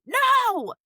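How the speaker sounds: sharp high shouting coach; coach yelling. Retold or imitated. Coach yelling at a kid on the field, loud and high. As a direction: bark corrections at a young player. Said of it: "yelling at you".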